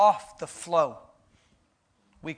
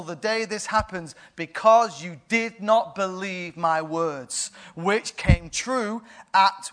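solemn angel pronouncement; 3 semitones higher, 3 dB louder, slower than usual. Acted. Gabriel sentences Zechariah to silence, solemn and stern. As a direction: deliver the sentence gravely.

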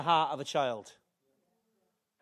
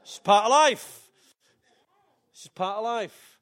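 loud, slow mock stoic declaration; hammed-up brave face, loud and slow; 3 semitones higher, 9 dB louder than usual. Fan pretending team loss doesn't hurt, loud slow mock stoicism.

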